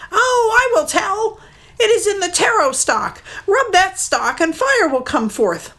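a frightened bird pretending to give in; mud hen. Mud hen tricking Maui again, high pleading bird voice.

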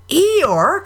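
high called-out exclamation; surprised cry. A narrator's reading. Everybody sees Eeyore float out, surprised cry. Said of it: "cried everybody".

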